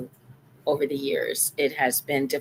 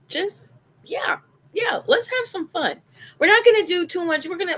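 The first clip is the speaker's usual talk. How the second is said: 1 playful high voice; 14 semitones higher, 4 dB louder than usual. Acted. Playful high-pitched aside.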